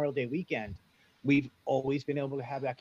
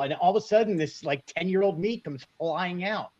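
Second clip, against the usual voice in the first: normal talking voice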